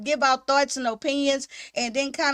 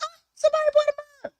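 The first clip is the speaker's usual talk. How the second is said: much higher, fast, squealing with delight; excited squeal; 13 semitones higher, much faster than usual. Retold or imitated. Seeing a mug sale on her merch deal, giddy squeal. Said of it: "I be like".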